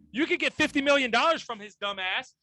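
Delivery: normal talking voice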